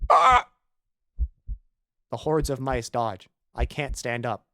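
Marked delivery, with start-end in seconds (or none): 0.1-0.5 sharp high yelp of pain. Real, not acted. Bitten by mice, a sharp yelp.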